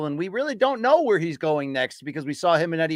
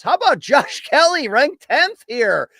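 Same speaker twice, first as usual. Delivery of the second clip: amused disbelief; 9 semitones higher, 5 dB louder than usual. Real, not acted. Mock surprise at Kelly's ranking.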